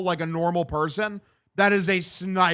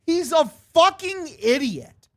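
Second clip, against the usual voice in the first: much higher, strained exasperated outburst; 10 semitones higher, 3 dB louder, slower than usual. Real, not acted. Gamer blowing up at Wilson, exasperated outburst. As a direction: let it burst out in disbelief. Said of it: "repeats insult louder".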